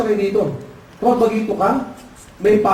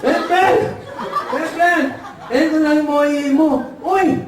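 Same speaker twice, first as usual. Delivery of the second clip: high, eager ngongo voice; nasal-speech characters; 6 semitones higher, slower than usual. Acted. Ngongo child handing the order to the customer, high nasal speech. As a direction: call out in muffled cleft-palate speech. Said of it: "Pagpunta ng anak ng ngongo".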